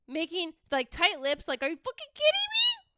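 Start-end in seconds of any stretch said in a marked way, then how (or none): 1.6-2.9 high-pitched incredulous squeal; incredulous outburst. Acted. Yoga student reacting to her teacher's chair pose order, incredulous. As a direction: squeak it out in outraged disbelief. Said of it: "I'm making a face like big eyes".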